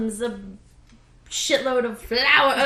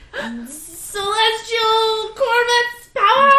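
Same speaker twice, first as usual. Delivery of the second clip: put-on bright magical girl; 9 semitones higher, 3 dB louder, slower than usual. Transformation chant.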